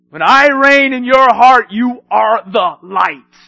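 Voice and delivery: loud proclaiming. Acted. Preacher voicing Christ, loud.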